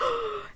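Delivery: high, hammed-up dramatic gasp; mock gasp. Mocking a dramatic reaction to seeing him, mock gasp. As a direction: gasp like a soap opera. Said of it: "it wasn't anything so dramatic".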